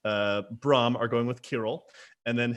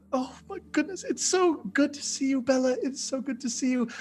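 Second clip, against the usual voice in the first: very high, gushing greeting; acted squealing delight, swelling at the end; excited enthusiasm; 14 semitones higher than usual. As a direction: gush breathlessly at a long-lost sister. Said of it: "so excited to see you, shouting in enthusiasm".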